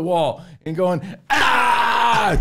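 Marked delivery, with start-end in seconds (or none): 1.3-2.4 raging yell. Retold or imitated. Dad yanking the TV out of the wall, raging yell. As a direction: roar like your dad ripping out the TV. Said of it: "my dad taking that T V and yanking it".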